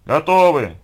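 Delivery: loud call from a distance; short, loud shout. A narrator's reading. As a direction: call out to the crew. Crew calling from behind house.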